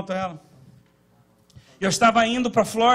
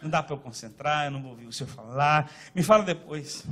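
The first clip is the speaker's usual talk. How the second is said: murmured prayer; 7 semitones lower, 7 dB quieter than usual. Retold or imitated. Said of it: "eu falei".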